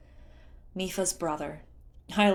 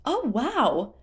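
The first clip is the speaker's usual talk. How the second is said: high, loud awestruck squeal; amazed wow; 7 semitones higher, 5 dB louder than usual. Acted. Tula seeing the prince up close, thrilled squeal. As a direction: let the wonder burst out.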